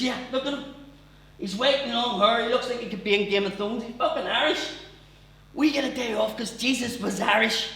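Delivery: put-on high, loud drunken insistence; drunk excited dad. Drunk dad insisting to his son Jesus was Irish, excited. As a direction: ramble it triumphantly.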